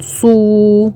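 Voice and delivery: صو with a long drawn-out oo; elongated madd. Shown as a demonstration. Teacher demonstrating madd with waw, stretched vowel on صو. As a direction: hold the vowel of صو long and steady. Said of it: "لازم نمد كده شوية".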